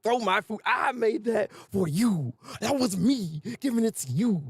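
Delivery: slow, drawn-out indignation; mock thoroughly upset. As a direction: stretch out each wounded accusation, hammed up. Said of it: "she is thoroughly upset about this".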